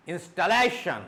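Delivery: installation with LA pushed up, then down; stress on LA. Shown as a demonstration. Teacher drilling T I O N words, stress on LA. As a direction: punch the LA in installation. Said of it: "it should go up".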